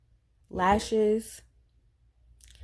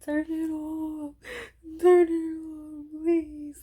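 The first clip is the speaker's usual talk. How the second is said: high, slow, tearful begging; crying plea; 9 semitones higher, slower than usual. Retold or imitated. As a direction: beg through tears for the song to stop. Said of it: "Like, crying".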